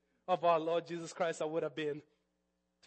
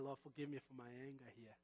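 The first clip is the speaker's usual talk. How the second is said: soft, lower humble prayer; quiet prayer; 5 semitones lower, 16 dB quieter than usual. Retold or imitated. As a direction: pray it low and hushed. Retold prayer for forgiveness.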